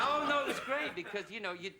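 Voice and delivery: real laughter; laughing. Real, not acted. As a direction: protest through laughter. Laughing reply to the host.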